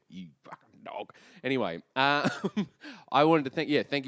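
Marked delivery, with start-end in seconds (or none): 0.1-1.1 Uncle Greg voice. Retold or imitated. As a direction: lower and much softer gruff uncle. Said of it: "That's how he says he loves you".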